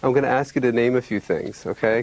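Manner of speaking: ordinary delivery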